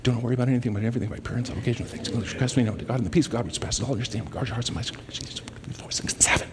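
racing through the verse, dying to a whisper; rushed muttered recital. Retold or imitated. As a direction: gabble the verse under your breath. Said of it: "and then I'm like".

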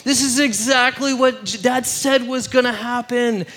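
high, loud mock wail of dismay; hammed-up freak-out, easing down toward the end. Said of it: "they're just freaking out".

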